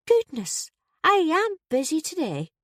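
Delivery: high-pitched put-on landlady voice. Landlady greeting officer at her door, high put-on voice. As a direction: voice a surprised landlady, pitched well up.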